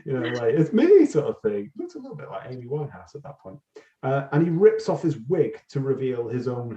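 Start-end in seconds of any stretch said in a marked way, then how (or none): 0.5-1.1 higher, louder playful villain taunt; mock gleeful villain. Host imitating Ragnar stripping off his beard, high and louder. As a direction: toss it off like a smug showman. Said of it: "laughing and going".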